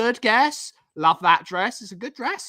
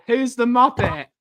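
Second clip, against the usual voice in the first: usual speaking voice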